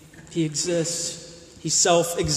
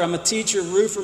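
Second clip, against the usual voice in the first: normal talking voice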